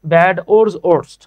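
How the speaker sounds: "ওর্স, ওর্স্ট" without the w, as ors, orst; "ওর্স, ওর্স্ট" starting on a plain o; Bengali mispronunciation. Shown as a demonstration. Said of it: "আমরা বাঙালি অনেকে বলি".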